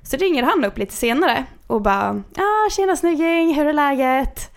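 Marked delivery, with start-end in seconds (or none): 2.4-4.5 high, slow, slick phone greeting; smarmy caller. Retold or imitated. Prankster on the phone greeting Sara, oily and friendly. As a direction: lay on the fake buddy charm.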